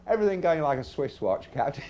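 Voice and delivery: high, loud, mock-cheery call; cheeky needling. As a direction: call it out brightly to wind him up. [name] gleefully needling the captain.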